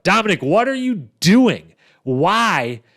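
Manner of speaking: baffled, calling out a player's blunder; exasperated disbelief. Real, not acted. Podcast host berating Dominic's elimination blunder, exasperated disbelief.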